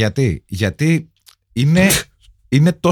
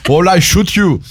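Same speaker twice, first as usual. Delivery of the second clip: put-on raised, heavy Austrian-accented threat; mock action-hero line in Austrian accent; Arnold Schwarzenegger; 5 semitones higher than usual. Co-host adds to Psycho gag, heavy Austrian accent. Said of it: "Με αυτή την βαριά αυστριακή προφορά".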